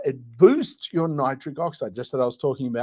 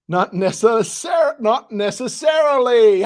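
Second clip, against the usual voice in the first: loud, high, drawn-out "not necessarily"; delighted realization; 8 semitones higher, 7 dB louder, slower than usual. Real, not acted. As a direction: shout "not necessarily" as the pun clicks. Suddenly gets the pun.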